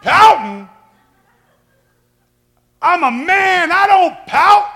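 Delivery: high, loud, offended denial; mock indignant shout. Husband denying he pouts to wife, loud and indignant. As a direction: bark it like a proud man insulted. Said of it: "you pouting?".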